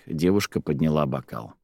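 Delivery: ordinary delivery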